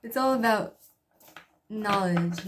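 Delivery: ordinary delivery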